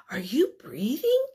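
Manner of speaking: breathy whispered question; a teacher whispering Hedgehog's line to the class. Acted. Reading Hedgehog's quote aloud.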